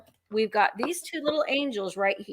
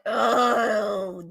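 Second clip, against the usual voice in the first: mock Easter Bunny villain; 4 dB louder, slower than usual. Seller voicing the bunny figurine to viewers, hammed-up and scheming. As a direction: play the scheming bunny taking over. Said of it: "he's like".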